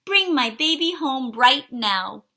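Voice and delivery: put-on mother ordering her baby home; demanding yell. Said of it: "Missus Brains yelled".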